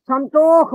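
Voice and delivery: সন্তোষ with a kh ending. Shown as a demonstration. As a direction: say সন্তোষ as "santokh", emphatically. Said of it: "উচ্চারণ করছে সন্তোষ".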